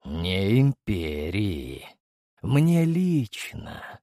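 very slow, low, with a smirk. Acted. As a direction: lay each word down with cold power. Emperor corrects the hero.

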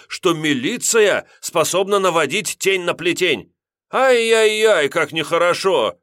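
высоко и громко, театрально оскорблённо; разыграть невинно обиженного; наигранная обида. A narrator's reading. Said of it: "с обидой выговорил". (вор театрально обижается на милиционера)

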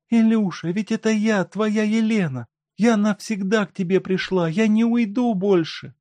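high, tearful-sounding wife voice; grieving wife. A narrator's reading. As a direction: speak through sorrow to him. Wife pleads with husband.

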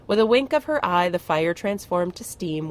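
ordinary delivery

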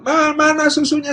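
high whine to mum; demanding child. Acted. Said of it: "kalau bangun dia bilang". (anak menagih dengan nada tinggi)

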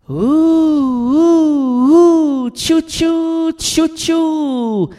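high wailing ghost noises; ghost moan. Acted. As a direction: moan like a spooky spirit. Esprit invoqué, bruits de fantôme. (gémissement de fantôme)

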